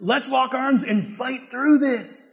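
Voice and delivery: slow, each word weighted; slow emphatic. Real, not acted. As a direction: lay it down slowly and firmly. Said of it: "I'll get this right".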